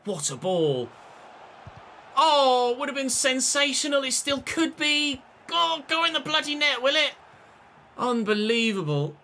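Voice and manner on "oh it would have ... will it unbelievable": near-miss excitement. Real, not acted.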